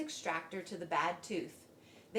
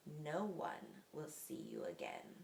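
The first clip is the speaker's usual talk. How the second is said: soft, slow, lower sinister aside; to himself; 4 semitones lower, 7 dB quieter, slower than usual. A narrator's reading. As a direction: murmur a hidden threat. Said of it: "said the fox to himself".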